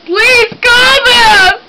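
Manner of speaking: fake hysteria. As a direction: wail it out like a faked breakdown. Prank caller fakes an emergency.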